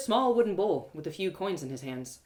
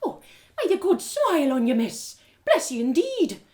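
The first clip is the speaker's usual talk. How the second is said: put-on higher, louder grateful old beggar; 8 semitones higher, 6 dB louder than usual. Beggar woman thanks narrator for the coin, gushing. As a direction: pour out blessings as a beggar woman.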